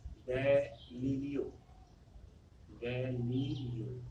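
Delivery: very slow, each syllable stretched; drawn-out name. Shown as a demonstration. Teacher giving students a scientist's name, drawn out slowly.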